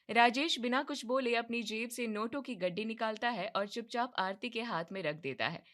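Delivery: ordinary talk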